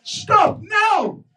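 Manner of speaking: high, frantic yell to stop; panicked shout. Acted. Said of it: "he shouted out to Hanuman".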